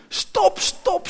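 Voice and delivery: high, urgent shout to halt. A narrator's reading. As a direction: shout out to stop a coach. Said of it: "schreeuwde ze". (hoog en luid schreeuwen)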